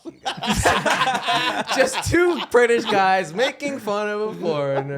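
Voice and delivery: loud, high, laughing complaint; laughing protest. Real, not acted. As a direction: protest through laughter at the teasing. Laughing protest at being teased.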